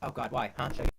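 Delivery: crackly cordless phone. Real, not acted. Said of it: "your audio is kinda weird".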